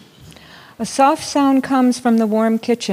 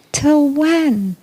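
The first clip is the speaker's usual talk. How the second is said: put-on impatient children; 7 semitones higher than usual.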